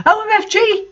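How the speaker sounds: high squealed OMFG, hammed up; mock shout. A narrator's reading. Narrator hamming the heroine's shocked shout. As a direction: yelp it out in mock outrage. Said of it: "I shouted out hungrily".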